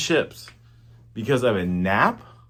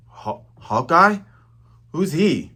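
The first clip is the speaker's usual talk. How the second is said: a slow, high, startled Usopp voice, louder; voicing Usopp; 5 semitones higher, 3 dB louder, slower than usual. Usopp hearing the name Hawkeye, slow startled stammer.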